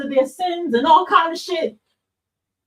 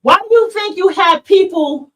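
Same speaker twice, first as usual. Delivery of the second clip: ordinary talk, nothing marked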